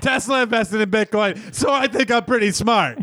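high, slow smug voice mocking a know-it-all; mocking smug investor. Mocking the investors who brag when it goes their way, slow and smug. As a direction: draw it out like a gloating fool. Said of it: "everyone's like".

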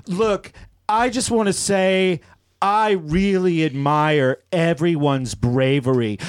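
slow, hammed-up mock admiration; sarcastic gushing. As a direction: gush slowly, building to a high-pitched finish. Reads own sarcastic post mocking bravery.

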